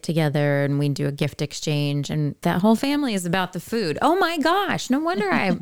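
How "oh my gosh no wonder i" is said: much higher, sudden amused realization; a high, startled exclamation; surprised. Real, not acted. Sudden realization about food and weight.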